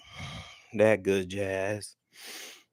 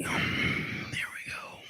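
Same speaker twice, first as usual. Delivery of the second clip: whisper; 8 dB quieter, much slower than usual. Real, not acted. Back on stream, quiet aside.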